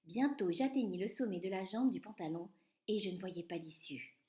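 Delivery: ordinary delivery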